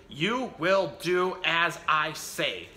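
mock shout of a bossy little miss. A narrator's reading. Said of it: "shouted little Miss Bossy".

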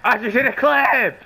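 high-pitched hype yell; hyped gamer bro. Acted. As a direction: scream it like you just won. Repeated hyped catchphrase yell.

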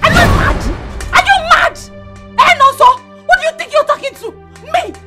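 high, outraged scolding; furious confrontation. Acted. Woman confronting boyfriend who begged her, high and furious.

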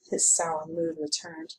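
ordinary delivery, nothing marked

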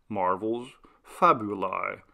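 plain reading voice

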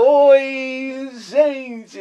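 high, loud radio hello; catchphrase greeting. Shown as a demonstration. Radio host greeting his audience, high and loud. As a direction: call it out to all listeners.

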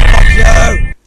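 loud, high raging shout; furious scream at the game; furious yell. Real, not acted. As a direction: explode at the screen.

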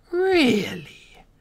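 high, loud mocking really; mock surprise. A narrator's reading. Queen mocking the captive's threat, high and loud. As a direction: feign amazement. Queen mocks his defiance.

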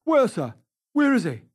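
loud, high-pitched urgent questions; shouting. A narrator's reading. Head keeper running up to Sir Geoffrey, shouting. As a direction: shout it across to him. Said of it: "he shouted".

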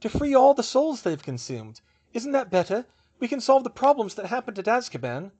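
high, loud, urgent plea; protesting. A narrator's reading. Hermione arguing for ridding the world of Dementors, protesting. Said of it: "Hermione protested".

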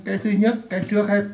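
ordinary delivery

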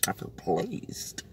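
whispered. Real, not acted. Proud of sorted wardrobe, said softly.

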